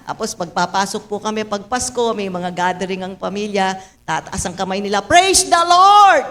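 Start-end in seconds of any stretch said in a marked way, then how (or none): 5.0-6.3 slow, high, loud relatives' cry; mock shout of praise. Relatives shouting at family gatherings, hammed-up loud praise. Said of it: "Sisigaw sila".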